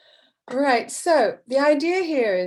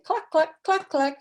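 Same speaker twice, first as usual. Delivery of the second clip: higher, lightly voiced hen clucks; hen clucking; 6 semitones higher than usual. Teacher voices the mother hen in the story, a bit higher. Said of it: "which is saying".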